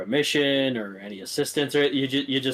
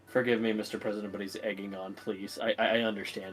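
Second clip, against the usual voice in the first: quiet pleading; 5 semitones lower, 8 dB quieter, faster than usual. Acted. Character pleading for it to stop.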